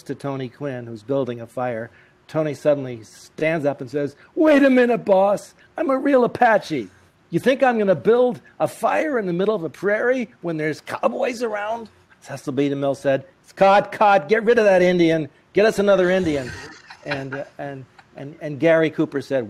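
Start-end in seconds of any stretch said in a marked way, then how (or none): 4.4-11.9 high, loud protesting objection; indignant young actor. Retold or imitated. Young Tony objecting to the director on set, loud and high. 13.4-16.5 loud, high barked orders; furious director. Retold or imitated. Director ordering the actor replaced, loud and barked.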